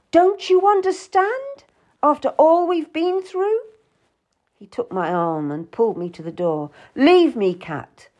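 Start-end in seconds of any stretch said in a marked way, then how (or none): 0.1-3.6 high, insistent, rising appeal; exasperated reproach. A narrator's reading. 6.9-8.1 louder, higher order; angry dismissal. A narrator's reading. Will throwing Kat out.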